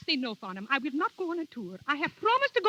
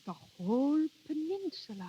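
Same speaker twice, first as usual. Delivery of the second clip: slow, wistful musing; dreamy; 4 dB quieter than usual. Acted. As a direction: savour the idea slowly. Dreamy repeat, slower.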